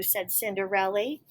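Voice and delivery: ordinary delivery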